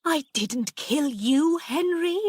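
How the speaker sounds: slow, high-pitched anxious denial; frightened denial. A narrator's reading. Aunt denying a killing to the ghost, frightened. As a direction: protest fearfully, climbing on the name.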